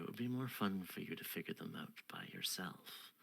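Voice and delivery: a hint of a coy spider queen; lightly voiced queen. GM as queen teasing the seeker, soft and coy.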